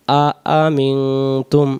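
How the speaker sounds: আ আমিনতুম split slowly into syllables; broken apart. Shown as a demonstration. Teacher splits the word for students, slowly. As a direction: break আ আমিনতুম into slow pieces. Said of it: "ভেঙে ভেঙে".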